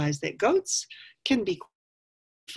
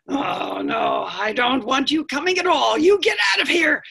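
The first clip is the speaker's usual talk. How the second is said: loud scolding that climbs higher; stern park ranger; 8 semitones higher, 7 dB louder than usual. Acted. Park ranger chasing off the goat, stern and loud. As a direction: build from firm refusal to a shout. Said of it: "The park ranger said".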